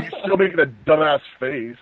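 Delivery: ordinary talk, nothing marked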